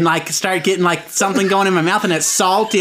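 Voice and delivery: ordinary delivery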